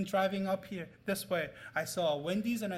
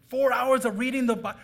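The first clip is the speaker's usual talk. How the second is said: louder, incredulous question; loud disbelief; 3 semitones higher, 7 dB louder than usual. Retold or imitated. Classmates repeat the four-hour claim, loud incredulous question. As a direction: blurt it out, amazed and disbelieving.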